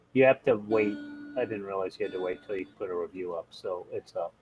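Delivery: usual speaking voice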